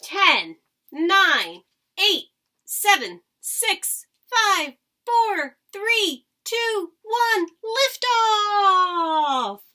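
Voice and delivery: loud, rising launch countdown building to a cry; excited countdown. Acted. Reading to kids, counting down the rocket launch, loud and excited.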